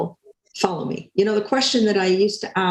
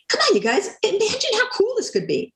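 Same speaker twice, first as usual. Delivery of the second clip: higher, eager coaxing of the team; rally them, pitch rising; excited pleading; 13 semitones higher than usual. Retold or imitated. Manager urges silent team, excited and high. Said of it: "I get a little more excited".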